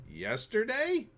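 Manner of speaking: drawn-out, high-pitched disbelief; slow, incredulous rise in pitch; incredulous question. Retold or imitated. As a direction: stretch it into a high, baffled question. Questioning the desk clerk's rule.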